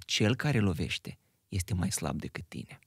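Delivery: ordinary talk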